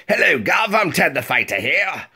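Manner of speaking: high, hammed-up Cockney greeting; mock Cockney. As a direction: call it out in an exaggerated Cockney accent. Mock British character voice demo.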